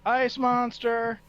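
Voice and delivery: loud, drawn-out shout; calling back. Acted. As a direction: holler the warning slowly down the hall. Shouts answer to Zarzul's yell.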